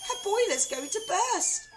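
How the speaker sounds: high, loud, half laughing half crying; panicked wail. A narrator's reading. Wife wailing as grandma steams. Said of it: "Missus Cranky wailed".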